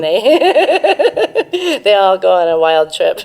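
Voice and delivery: laughing through the words about the wild trip; laughing at the silly picnic story while telling it. Real, not acted. Telling the guest about the hashish picnic, laughing.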